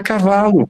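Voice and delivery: "cavalo" run together quickly as a whole word; "cavalo" in one go, no breaks. Shown as a demonstration. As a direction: blend the syllables into "cavalo". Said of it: "ou eu falo rápido".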